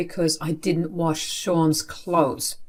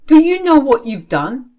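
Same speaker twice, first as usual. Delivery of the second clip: higher, louder accusing question; angry accusation; 7 semitones higher, 7 dB louder than usual. Acted. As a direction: throw the blame at her. Father accuses angrily.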